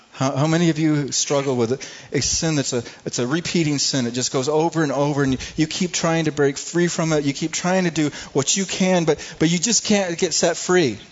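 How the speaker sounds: loud, driving sermon delivery; full-force preaching. Acted. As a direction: preach it hard to the congregation. Builds the sin appeal.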